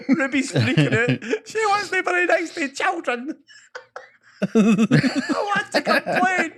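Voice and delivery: high, slow words through laughter; laughing while talking. Real, not acted.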